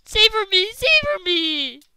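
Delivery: panicked plea. Real, not acted. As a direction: wail it out desperately. Crying out during zombie attack.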